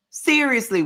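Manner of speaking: ordinary talk, nothing marked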